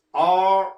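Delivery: "R" drawn out long and loud; R sound demonstration. Shown as a demonstration. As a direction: hold the English "R" loudly for learners. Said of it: "R 발음은 이렇게". ("R"을 크고 길게 시범 발음)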